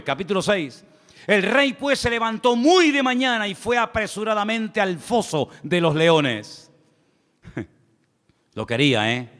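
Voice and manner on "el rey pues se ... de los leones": raised, slowed preacher's Bible reading; scripture reading. Acted. Preacher reading Daniel to the church, solemn and raised. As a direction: read the verse aloud to the congregation. Reads the Bible passage aloud.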